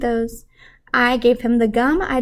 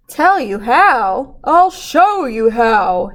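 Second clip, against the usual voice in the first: slow, louder fatherly voice; a hint of a knowing dad; dad's voice; 5 dB louder, much slower than usual. Dad teasing his son, slow and knowing.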